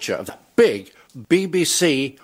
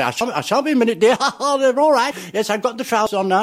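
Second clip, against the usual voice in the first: comic stage voice; 11 semitones higher than usual. Retold or imitated. Comic's stage character offstage voice.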